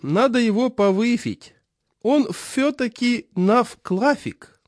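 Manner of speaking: put-on high, slow lisping old man; toothless old man. A narrator's reading. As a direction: mumble slowly through gums. Chairman voiced.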